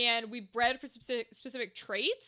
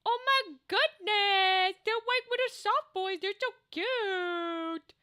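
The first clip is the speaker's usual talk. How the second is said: high, slow, squealy gushing; gushing over cute; 8 semitones higher, slower than usual. Real, not acted. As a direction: melt over something adorable. Seeing cute character art.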